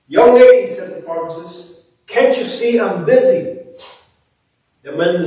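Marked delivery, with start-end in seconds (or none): 0.1-0.7 loud, high impatient pharmacist; annoyed shout. Acted. Busy pharmacist scolding the little girl, loud and irritated. 2.1-3.6 impatient pharmacist. Acted. Pharmacist snaps at girl.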